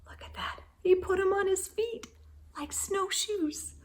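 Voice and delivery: high, quick unvoiced whisper. Real, not acted. Parent pointing out the snowshoes, whispering. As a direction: whisper it excitedly.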